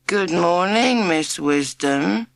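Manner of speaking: slow, dragging chant with no energy; unenthusiastic. A narrator's reading. Class three greeting their new teacher without enthusiasm. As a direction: drone it like bored kids who don't care. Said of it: "said class three unenthusiastically".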